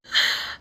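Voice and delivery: a short sharp gasp of mock shock; retold gasp. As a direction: gasp as if hit by the news again. Boyfriend refuses to enter dance.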